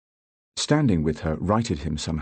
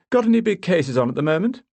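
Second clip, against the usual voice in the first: breezy posh small talk; 12 semitones higher, 5 dB louder than usual. Acted. Bamber's careless small talk.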